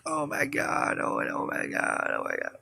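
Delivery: high, hushed distraught wail; imitated tearful upset. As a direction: mimic a woman beside herself, thin and quiet. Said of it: "really upset".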